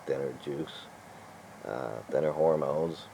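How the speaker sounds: ordinary talk, nothing marked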